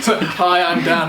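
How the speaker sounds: loud cheery YouTuber intro; mock video intro. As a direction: announce yourself like opening a vlog. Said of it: "which he stated in the first video".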